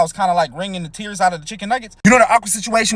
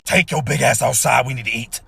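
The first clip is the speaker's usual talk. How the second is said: stomach voice; 10 semitones lower than usual. Acted.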